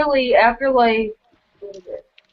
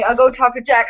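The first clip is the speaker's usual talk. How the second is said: normal talking voice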